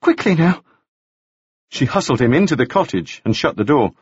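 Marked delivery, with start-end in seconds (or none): 0.0-0.6 lightly voiced woman, higher and hurried; a hint of a frightened woman urging him on; Margaret urgent. A narrator's reading. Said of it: "she said".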